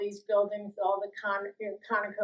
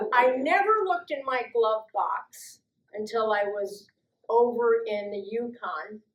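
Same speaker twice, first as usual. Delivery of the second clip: ordinary delivery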